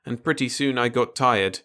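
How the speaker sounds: ordinary delivery, nothing marked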